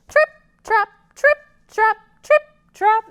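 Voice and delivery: high, loud trip trap; loud hoof chant. Shown as a demonstration. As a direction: clatter it out like hooves. Medium goat crosses.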